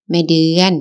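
usual speaking voice

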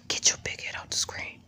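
soft unvoiced whisper. Real, not acted. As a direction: hush it under your breath. Whispers the repeat to baby.

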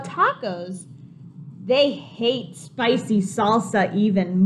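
animated picture book voice; reading to children. A narrator's reading. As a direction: make it exciting for the kids.

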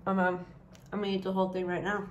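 eating cheesecake mid-sentence; chewing while talking. Real, not acted. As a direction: mouth partly full. Seen 2: Bites cheesecake at -1.0, then speaks with lips working, holding bowl.

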